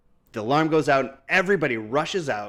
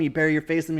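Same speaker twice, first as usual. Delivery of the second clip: usual speaking voice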